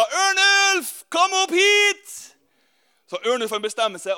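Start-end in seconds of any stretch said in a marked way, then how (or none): 0.0-2.2 calling from the sky. Retold or imitated. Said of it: "ørnemor roper".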